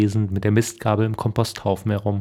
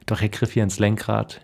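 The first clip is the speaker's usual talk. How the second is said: usual speaking voice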